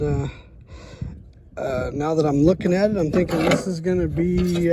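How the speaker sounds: usual speaking voice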